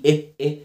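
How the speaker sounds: "إيه" as short separate e sounds. Shown as a demonstration. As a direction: demonstrate short e in "إيه", as in bed. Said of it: "بنطقها شورت إي".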